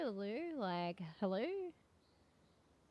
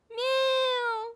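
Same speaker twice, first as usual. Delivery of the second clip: high, loud wailing meows; imitating the cat; 14 semitones higher, 11 dB louder than usual. Her cat wailing outside the bathroom door, high loud mock meows. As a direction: mimic a cat yowling at the door, drawn out. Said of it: "and I hear this".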